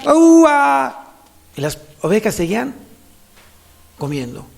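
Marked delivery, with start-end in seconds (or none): 0.0-1.1 high, drawn-out sheep-herder's holler; imitated shouted call. Three strangers testing the sheep, shouted imitation of shepherd's call. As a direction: shout it like someone copying a shepherd. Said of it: "Y gritaban".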